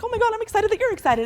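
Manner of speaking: high, gushing excitement; excited. Retold or imitated. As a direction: squeal it back, matching the energy. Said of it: "And then I'll be like".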